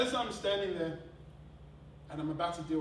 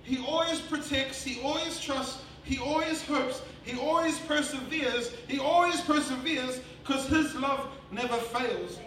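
raised, emphatic sermon cadence; impassioned preaching; 6 semitones higher, 6 dB louder than usual. Real, not acted. Preacher telling congregation God's love never fails, impassioned preaching. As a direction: proclaim each promise with force.

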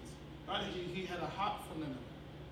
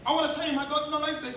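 raised, loud sermon delivery; forceful preaching; 8 semitones higher, 7 dB louder than usual. Real, not acted. Preacher denouncing media's delight in evil, forceful preaching. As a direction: drive it home to the congregation.

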